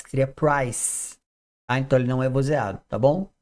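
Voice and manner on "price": price, slowly, ending in a hissed S; voiceless s ending. Shown as a demonstration. Teacher explaining the silent E, price with S sound. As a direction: draw price out and end on a clean S. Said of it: "esse C teria aquele som do S".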